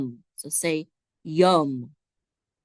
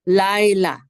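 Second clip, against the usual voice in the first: Laila stressed on LAI, louder; first-syllable stress; 6 dB louder than usual. Shown as a demonstration. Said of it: "accent is on the first syllable".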